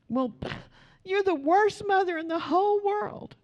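high, laughing mock of a pouting daughter; sulky daughter. Mother imitating her daughter's complaint, high and mocking. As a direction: play the kid calling mom the worst, with a laugh.

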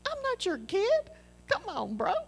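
high mock protest, then easing off; playful protest. To Steve, a high joking protest that he is no kid. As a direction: squeak out the protest, laughing at it. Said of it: "I was like".

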